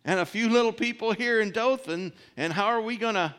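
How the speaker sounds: high, loud disbelieving protest; a servant who thinks his master has lost it; incredulous servant. Retold or imitated. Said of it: "It's like".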